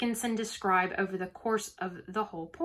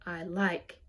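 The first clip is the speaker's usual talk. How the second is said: "I" held back, "like" given more power; stress on LIKE; 4 dB quieter than usual. Shown as a demonstration. Teacher showing students the stressed syllable, stress on "like". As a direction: lean on "like" after a light "I". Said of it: "we give more power to like".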